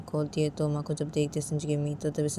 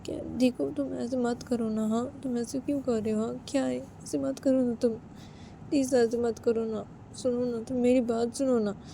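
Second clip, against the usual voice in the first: pleading wife; 8 semitones higher than usual. Acted. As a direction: coax him to listen, gently begging. Uma pleads with Sanjay.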